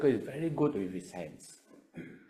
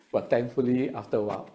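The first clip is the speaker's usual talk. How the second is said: normal talking voice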